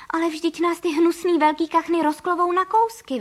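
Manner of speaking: high, loud protest; storybook bird crying out in protest; alarmed cry. A narrator's reading. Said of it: "vykřikla paní Frejková".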